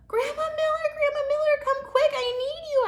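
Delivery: put-on very high, loud frightened kid scream; scared girl shouting for grandma; panicked shout. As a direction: scream for grandma to come quick. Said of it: "I shouted".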